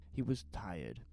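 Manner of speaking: ordinary delivery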